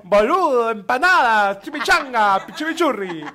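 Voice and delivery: loud hammed-up fake Argentine words; mock Argentine accent. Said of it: "Estás hablando como tabasqueño".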